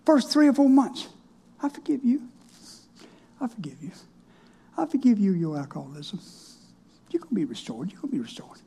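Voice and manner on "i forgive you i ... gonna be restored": soft, murmured repetition; murmure-le doucement comme une prière; murmured blessing. Retold or imitated. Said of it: "how many times have I said subliminal".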